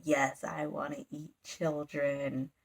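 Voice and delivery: low, soft, slow devil voice; bat devil. Retold or imitated. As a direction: savor it low and slow like a monster. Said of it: "they just talk so funny".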